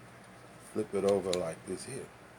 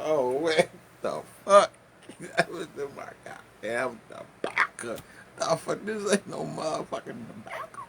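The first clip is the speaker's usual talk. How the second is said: shocked outburst; 7 semitones higher, 4 dB louder than usual. Retold or imitated. Smoker discovering his tobacco is spiked, shocked outburst. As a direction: react as if the tobacco is fake. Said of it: "I was like".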